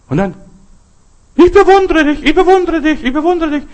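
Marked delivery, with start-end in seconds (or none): 1.3-3.6 high, loud calls, slightly fading; echoing shout. Acted. Child calls to the mountain, high echoing shout. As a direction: imitate praise echoing back, high and loud. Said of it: "dieses halt alles raus".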